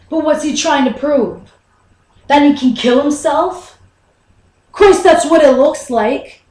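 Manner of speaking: exasperated. Acted. Son vents to Mom about Dad returning to work, exasperated. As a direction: flare up in worried anger. Frustrated at father's stubbornness.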